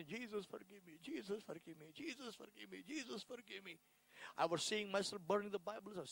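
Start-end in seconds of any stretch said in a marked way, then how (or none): 0.1-3.8 soft, repeated pleading; retold crying prayer. Convert recalling two hours of crying, soft repeated plea. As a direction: imitate begging forgiveness over and over, softly. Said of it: "I was crying and crying".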